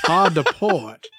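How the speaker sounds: slow hammed-up Southern pirate call; lightly drawled Georgia pirate order; Southern pirate accent. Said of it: "like a Georgia pirate".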